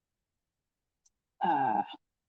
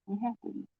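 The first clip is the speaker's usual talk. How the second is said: ordinary delivery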